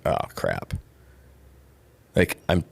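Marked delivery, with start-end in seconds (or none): 0.0-0.7 low, soft sinking realization; muttered dismay. Retold or imitated. Realizing he was chasing money, low and soft. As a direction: drop it low like caught out.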